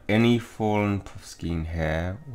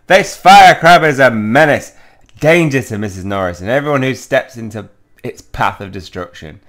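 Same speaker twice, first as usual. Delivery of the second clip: hammed-up outraged proclamation, much louder and higher; loud sarcasm; 4 semitones higher, 15 dB louder than usual. Student mock-denouncing the Firecrab to Filch, loudly sarcastic. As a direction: overdo the alarm, then settle down. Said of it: "I hope he can hear the sarcasm".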